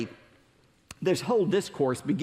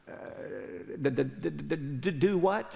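shocked stammer; 4 dB quieter than usual. Acted. Ruler stunned by command.

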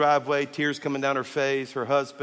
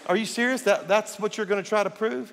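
much higher, disbelieving; incredulous; 6 semitones higher than usual. Retold or imitated. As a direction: ask as if you can't believe it. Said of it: "I was like".

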